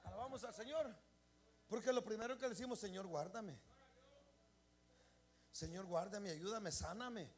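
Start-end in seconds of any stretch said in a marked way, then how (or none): 2.8-7.3 soft, lower pleading prayer; humble prayer. Retold or imitated. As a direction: pray it softly.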